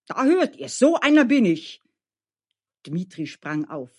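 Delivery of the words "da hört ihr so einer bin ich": high, loud, triumphant coachman; proud boast. Acted. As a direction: crow with pride to the others. Proud coachman boasts after praise. (stolz auftrumpfend)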